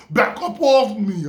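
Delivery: imitated New Yorker warning someone off; New York attitude. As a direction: push them back with your voice, New York style. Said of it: "the New York came out".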